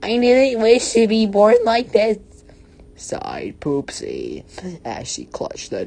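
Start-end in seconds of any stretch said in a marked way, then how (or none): 0.0-2.2 high, loud put-on wail; hammed-up protest. A narrator's reading. Poopsie answers the bully Bertha, high loud melodramatic protest. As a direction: cry it out in a squealing character voice.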